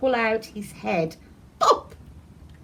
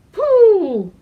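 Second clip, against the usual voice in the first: high, loud cry of disgust; loud high yuck; disgusted pooh; 11 semitones higher, 11 dB louder than usual. Acted. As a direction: react to a terrible smell. Smelling the compost bin.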